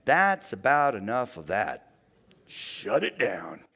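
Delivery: slow, low stern voice shutting it down; a hint of a booming God cutting someone off; mock stern God. God imagined silencing a complaining believer, slow and stern. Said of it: "God doesn't come roaring in saying".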